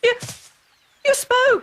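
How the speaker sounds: jolted by a talking cat; startled surprise. Acted. Boy startled when the cat suddenly speaks, shocked surprise.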